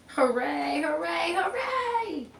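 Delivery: rising cheer. A narrator's reading. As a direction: climb to a joyful cheer. Triumphant cheer in story.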